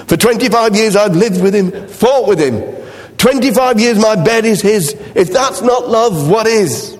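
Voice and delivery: a tired wife sighing it out. Retold or imitated. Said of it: "Golda sighs".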